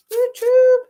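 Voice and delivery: high, loud YouTube; calling out. Real, not acted. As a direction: hail the viewer by name. Calling out to a viewer.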